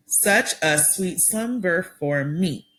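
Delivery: slow "Such a sweet slumber for me", every syllable spaced out; broken into syllables. Shown as a demonstration. Teacher breaking a poem line into syllables, slowly. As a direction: tap out "slumber" as two beats. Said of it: "I'm breaking it down into syllables".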